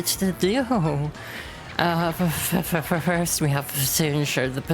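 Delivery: high, stammering panic; frantic engineer stammering at the intruders; panicked stutter. Acted. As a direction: trip over every word in fright.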